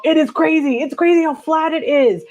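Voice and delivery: loud, high-pitched amazement; amazed exclamation. Real, not acted. Marveling to viewers at flat Texas, amazed.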